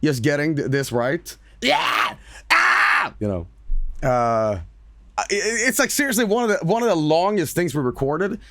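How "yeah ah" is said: harsh unvoiced yelled takes; imitated recording-session screams; re-enacted yells. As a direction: bark out a yell for the mic. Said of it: "sat there for twenty goddamn minutes".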